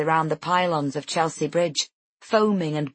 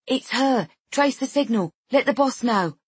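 higher, hurried woman's aside; quick urgent instructions, a bit higher; 6 semitones higher than usual. Acted. Woman aside, phone covered.

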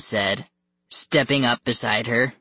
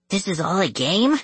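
raised pitch, rising at the end; lightly voiced puzzled boy; incredulous question; 7 semitones higher than usual. A narrator's reading. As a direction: ask in mild disbelief. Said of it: "Chang asked".

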